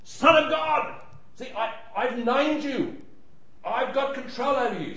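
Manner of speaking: high, gloating demon voice; demon's claim. Acted. Demon claiming power over Jesus, high gloating. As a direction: taunt Jesus as if you've won. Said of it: "the demon says".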